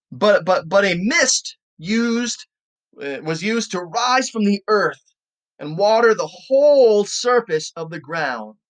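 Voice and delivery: slow, emphatic Bible reading; scripture reading. Acted. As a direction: read the verse out deliberately, weighting key words. Reads scripture aloud.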